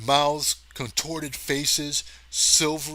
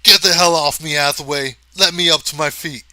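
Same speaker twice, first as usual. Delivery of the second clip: loud, higher, irritated bark; 4 semitones higher, 12 dB louder than usual. A narrator's reading. Marnigan snaps at Hathaway to get off him. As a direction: shove him off in annoyance. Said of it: "and then irritated".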